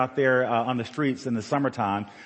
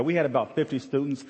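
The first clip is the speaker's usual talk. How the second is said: normal talking voice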